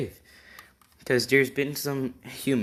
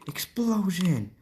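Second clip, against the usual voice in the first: drawn-out, much higher battle cry; 10 semitones higher, slower than usual. Acted. Fighter shouts his attack name, slow high yell. Bakugo casts attack spell.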